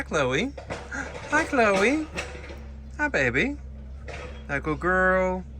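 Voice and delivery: sing-song, high-pitched pet talk, rising on each hi. Real, not acted. Greeting her pet bird Chloe on its runway, high cooing baby talk.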